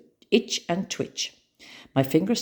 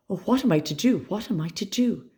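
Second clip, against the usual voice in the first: despairing; 6 semitones higher than usual. A narrator's reading. Despairing girl.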